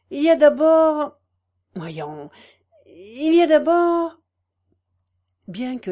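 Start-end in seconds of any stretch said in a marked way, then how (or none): 0.1-4.2 loud halting hunt for a name; half-shouted stalling, casting about; hesitant searching. Acted. Farmer's wife stalling out loud, half-shouting.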